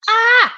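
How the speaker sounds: short, high, loud yelp of frustration; exasperated little shriek; frustrated scream. Real, not acted. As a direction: let out a sudden high "Ah!". Frustrated at having to multitask.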